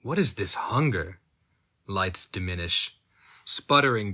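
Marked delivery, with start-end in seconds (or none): none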